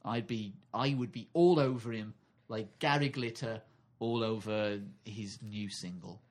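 usual speaking voice

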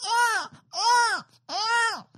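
put-on shrill baby wail, very high and loud; Portuguese baby crying. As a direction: cry like a newborn again. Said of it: "this is a Portuguese baby".

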